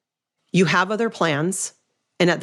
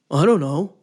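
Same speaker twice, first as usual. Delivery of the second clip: mock husband; 3 dB louder than usual. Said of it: "Probably exactly like that".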